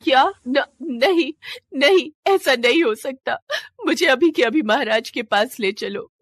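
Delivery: shocked disbelief. Acted. Shivalika hears her husband was killed, shocked and crying out. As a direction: refuse to believe the king is dead.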